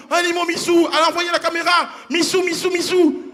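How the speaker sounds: high sing-song encouragement; mock doting parent. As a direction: cheer on a baby's first steps. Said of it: "on le tient comme ça".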